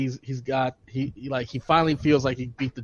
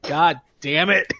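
slow, drawn-out exhausted sigh of a line; a worn-out hero hauling himself up; mock-tired superhero groan; weary Batman; 2 semitones higher, 3 dB louder, slower than usual. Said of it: "you just see him getting up so slow".

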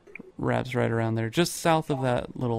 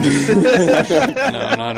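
laughing denial; 2 semitones higher, 12 dB louder than usual. Real, not acted.

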